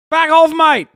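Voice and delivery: loud, high Aussie retort; hammed-up Aussie, loud and high; mock Aussie accent. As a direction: bark it like a cheeky Aussie bloke. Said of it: "you sound like a New Zealander".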